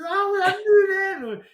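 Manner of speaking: high, loud, drawn-out outburst; excited exclamation. Retold or imitated. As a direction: re-enact a delighted cry of recognition. Retelling delighted recognition of accent.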